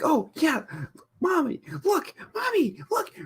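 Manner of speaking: put-on very high, squealing kid voice; excited child voice. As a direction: squeal like a thrilled little kid. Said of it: "they're like".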